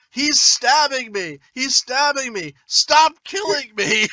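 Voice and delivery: high, slow imitation of a movie victim; mock victim yell. Correcting a friend's movie quote, mock victim yell. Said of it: "or does he yell".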